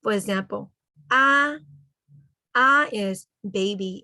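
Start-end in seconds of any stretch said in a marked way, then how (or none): 1.1-1.6 "ah" high, loud and held; vowel-and-tone demonstration. Shown as a demonstration. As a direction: sound out "ah" as one raised, sustained vowel. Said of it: "and the tone only".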